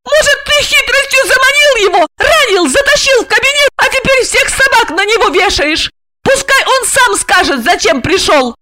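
very high, hysterical accusation; frantic high-pitched female tirade; shrieking. A narrator's reading. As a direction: screech accusations at him. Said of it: "завизжала Ольга".

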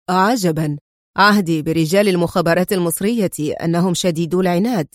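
ordinary delivery

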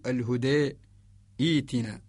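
الهدائتنا split, tilted huday, pause, then eetina; Warsh stopping form. Shown as a demonstration. Teacher showing Warsh with a stop, الهدائتنا slowly in two parts. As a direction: stop slowly after tilted الهدى, restart on long ee. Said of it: "إذا وقفنا نقول لورش".

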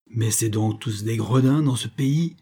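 ordinary delivery

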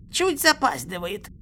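high, choked character voice; high-pitched strangled reply; gurgling. A narrator's reading. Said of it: "пробулькал Ву".

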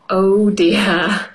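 usual speaking voice

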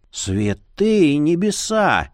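slow, stunned outburst; shocked exclamation. A narrator's reading. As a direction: blurt it out in shock. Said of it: "не сдержался Марий". (потрясённый возглас)

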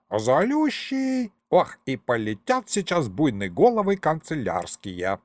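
high, gloating ghost voice; the ghost head warning the chancellery, gleeful. A narrator's reading. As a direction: relish the coming punishment.

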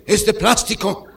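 high, loud mock indignation; abuelo indignado. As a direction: protest slowly and loudly as an outraged grandpa. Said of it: "el abuelo indignado dijo". (protesta indignada de abuelo, aguda y fuerte)

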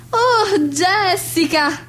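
high, long drawn-out social gush; effusive greeting. Acted. Hostess greeting an invited friend on the phone, effusive. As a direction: stretch the name out with gushing delight.

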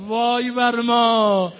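anguished cry. Retold or imitated. Old man cries out in grief at the head, loud slow wail. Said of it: "پیرمرد داد زد".

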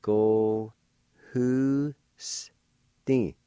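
"Ko hu sti" sounded out; careful syllables. Shown as a demonstration. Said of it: "First part is pronounced like this".

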